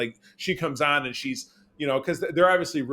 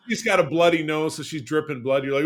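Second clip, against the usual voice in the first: normal talking voice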